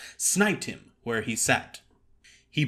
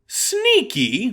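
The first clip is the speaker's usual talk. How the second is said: pained, rising moan of disbelief; 10 semitones higher, 7 dB louder than usual. Acted. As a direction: moan the word out, wounded. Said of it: "moaned Henry".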